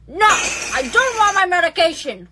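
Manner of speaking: ordinary talk, nothing marked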